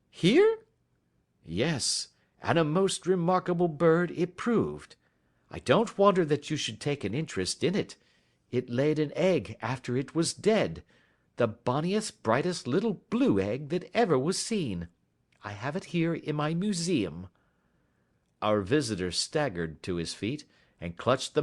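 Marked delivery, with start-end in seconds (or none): 0.1-0.6 very high, loud startled question; shocked, voice jumping way up; high startled cry from the nervous man; astonished squeak. A narrator's reading. Ryder astonished. 1.5-17.3 a hint of a brisk, higher gentleman; polite detective taking charge, lightly voiced; lightly raised gentleman's voice; lightly voiced Holmes. A narrator's reading. Holmes speaks.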